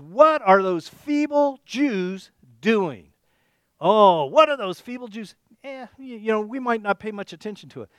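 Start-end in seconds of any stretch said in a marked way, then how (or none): 0.0-5.3 mocking sneer. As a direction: drawl it out with contempt. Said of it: "And he says".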